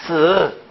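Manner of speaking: 쓰 as Mandarin si with falling tone; tense s and falling tune on 쓰; Chinese pronunciation. Shown as a demonstration. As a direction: model 쓰 alone as the Chinese word for four. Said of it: "요거 바로 중국말로 발음이".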